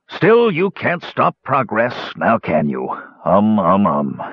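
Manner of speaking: plain reading voice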